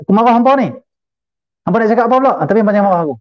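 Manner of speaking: high-pitched pretend telling-off; hammed-up indignant scolding; mock scolding. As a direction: imitate someone angrily having a go. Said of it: "kita marah orang".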